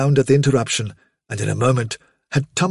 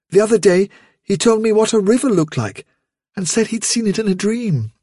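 higher-pitched mother's voice; higher, chatty mother rabbit; 8 semitones higher than usual. Acted. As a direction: speak up as the mother, in a higher register. Vilthuril speaks.

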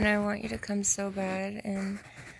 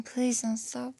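ordinary delivery

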